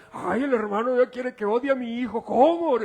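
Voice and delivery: loud, high-pitched offended wail; mock indignation. Churchgoer complains about the preacher on leaving, mock indignation. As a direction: imitate a scandalized parent protesting, very loud and high. Mocks an offended mother's objection.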